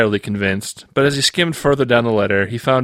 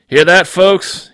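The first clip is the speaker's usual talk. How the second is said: slow, loud, higher call to the room; 8 semitones higher, 7 dB louder, much slower than usual. Acted. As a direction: announce it to the whole office. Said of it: "he called out".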